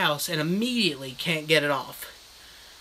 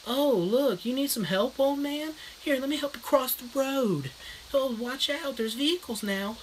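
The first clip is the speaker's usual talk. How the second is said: put-on higher, softer sarcastic kid voice; a bratty kid teasing an old man; mock kid voice; 7 semitones higher, 4 dB quieter than usual. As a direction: kids sassing the masked man in a higher voice. Kids mocking old man.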